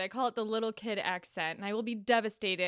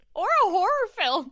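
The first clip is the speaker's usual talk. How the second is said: excited squeal; 13 semitones higher, 10 dB louder than usual. Real, not acted. Joking banter, squealed suggestion.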